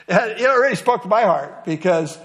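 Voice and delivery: usual speaking voice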